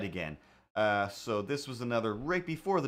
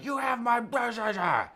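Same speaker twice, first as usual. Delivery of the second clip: high, garbled couple's screaming match; mock yelling; 7 semitones higher, 4 dB louder than usual. Neighbours fighting over keys heard through the wall, mock yelling. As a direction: imitate neighbours shrieking muffled nonsense at each other. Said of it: "one of them is yelling".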